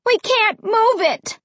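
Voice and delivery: high, desperate protest; frustrated, terrified cry. A narrator's reading. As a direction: cry out in panicked defeat. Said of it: "so terrified".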